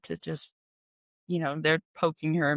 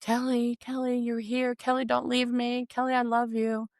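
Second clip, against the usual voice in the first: higher, plaintive voice; pleading mother; 6 semitones higher than usual. Retold or imitated. Delirious mother begging her daughter to stay, pleading. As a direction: imitate a frail mother clinging to her daughter.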